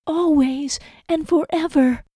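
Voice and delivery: high, slow echoing vow; Lizzie's echo. A narrator's reading. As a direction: echo his words slowly in a high voice.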